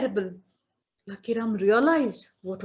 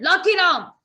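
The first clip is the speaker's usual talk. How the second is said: loud, high angry call of the name; angry shout; 7 semitones higher, 7 dB louder than usual. Acted. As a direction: bark his name in fury. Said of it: "Yama got very angry".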